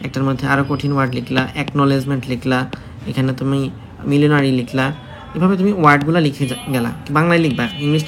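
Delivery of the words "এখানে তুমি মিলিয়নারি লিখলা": ordinary talk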